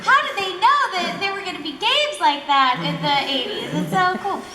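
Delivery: high, loud wide-eyed wonder; mock amazement. Panelist playing along with the eighties joke, mock amazement. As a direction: marvel with playful disbelief.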